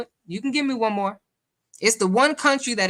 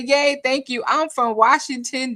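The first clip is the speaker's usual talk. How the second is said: higher-pitched mock cheer; imitated excited winner; 5 semitones higher, 4 dB louder than usual. Host voicing a winner's chat message, lightly excited. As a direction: read it as a pleased winner.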